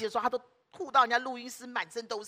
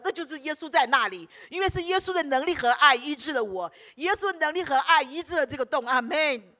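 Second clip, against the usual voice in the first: joyful exclaiming; 5 semitones higher, 7 dB louder than usual. Retold or imitated. Barbie rejoicing at the news.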